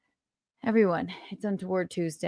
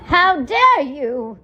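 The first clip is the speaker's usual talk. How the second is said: slow, loud, higher mock indignation; mock outrage; 7 semitones higher, 9 dB louder, much slower than usual. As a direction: play the offended diva. Mock outrage at non-tippers.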